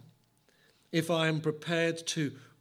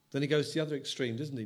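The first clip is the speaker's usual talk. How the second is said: ordinary talk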